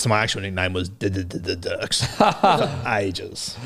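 slow, with the stuttered D repeated in "D-D-D-D-Dirks"; stuttered name. Shown as a demonstration. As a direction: stammer on the D of "D-D-D-D-Dirks", laughing about it. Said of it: "I had a chronic stutter".

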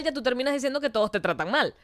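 usual speaking voice

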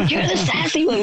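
a hint of the excited driver; mock driver.

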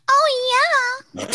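high, stretched exclamation; signature oh yeah. Real, not acted. Podcaster doing her habitual exclamation before the toast, high-pitched. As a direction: squeal it out, drawn long, ready to laugh. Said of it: "I do the".

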